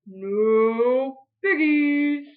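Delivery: very slow, lowing no piggies; cow voice. Acted. The cow answering Grinch, very slow. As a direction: moo it out.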